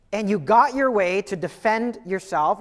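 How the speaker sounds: ordinary delivery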